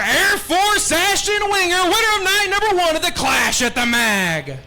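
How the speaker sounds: high, drawn-out hype introduction; announcer call. Real, not acted. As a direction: belt the winner's name to the crowd. Announcer calling the race winner.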